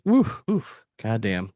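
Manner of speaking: ordinary delivery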